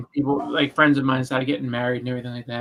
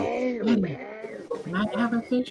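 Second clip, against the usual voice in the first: daughter asking; 10 semitones higher, 5 dB quieter than usual. Retold or imitated. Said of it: "so she's, you know".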